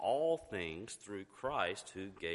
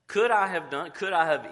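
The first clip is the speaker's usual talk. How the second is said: emphatic shout; 8 semitones higher, 9 dB louder than usual. Real, not acted. Preacher's emphatic question.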